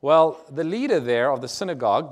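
usual speaking voice

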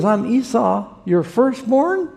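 high-pitched, mildly indignant reply; baffled answer. Retold or imitated. Son identifying himself to his father, baffled high reply. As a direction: answer as if it should be obvious, pitch climbing.

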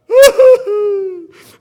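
high, loud imitated wailing cry; mock wail. Preacher imitating his mother crying to get her way, loud wail. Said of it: "she would just start crying".

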